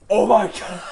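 loud, high laughing outburst. Real, not acted. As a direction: burst out laughing. Bursts out laughing.

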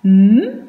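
M tenu, comme un murmure; son M isolé. Shown as a demonstration. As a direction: faire durer le son M. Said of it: "j'entends le". (son consonantique M prolongé)

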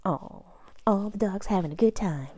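ordinary delivery, nothing marked

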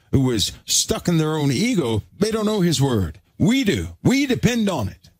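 preacher building, pitch climbing; building sermon. Acted. Rising preaching call and response.

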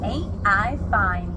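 slow, loud tiger boast; proud declaration. A narrator's reading. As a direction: declare it slowly, showing off. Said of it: "he declared".

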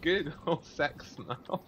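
"Google sex now" spelled out slowly; slow repeat. Shown as a demonstration. Repeating to a friend who asked what, slowly.

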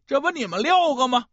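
plain reading voice

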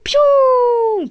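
very high, very slow cartoon zap; long high attack cry. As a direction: stretch the zap sound. Drawn-out attack sound.

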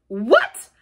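loud, outraged outburst; scandalized cry. A narrator's reading. Badger hearing Toad take it back, scandalized. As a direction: burst out in shocked disapproval. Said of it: "cried the badger, greatly scandalized".

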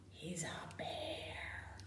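hushed, breathy gasp of surprise; a secret reveal, whispered. Pretend. Teacher whispering to children about the bear.

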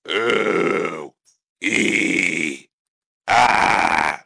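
grunty ooh, ee, ah vowels; ultra low rumble. Shown as a demonstration. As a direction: sing the vowels through a low throat rumble. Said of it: "Something like this".

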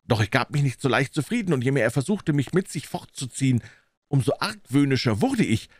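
ordinary delivery, nothing marked

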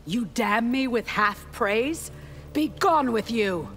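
high-pitched, offended retort; affronted and imperious, pitched high; indignant dismissal. Acted. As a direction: send a rival away in indignation. Scorns faint praise, sends rival away.

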